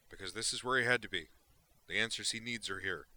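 loud, relentless rant of a wild reporter; fired-up gonzo journalist. Acted. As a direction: hammer it out like a manic columnist. Duke answers Valeris.

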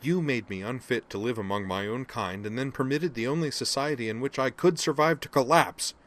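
furious accusation into his face; snarling anger. Acted. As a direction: accuse him with mounting fury. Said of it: "she all but snarled up into his face".

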